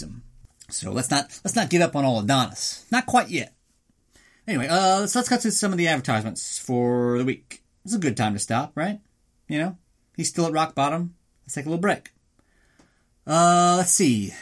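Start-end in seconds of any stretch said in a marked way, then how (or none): none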